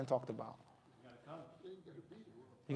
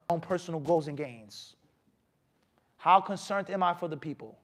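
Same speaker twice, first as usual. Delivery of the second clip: ordinary talk, nothing marked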